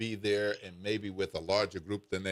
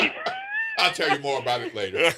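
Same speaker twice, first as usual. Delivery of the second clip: loud, fast aside through laughter; real laughing; 8 semitones higher, 7 dB louder, faster than usual. Real, not acted. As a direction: toss it off while cracking up.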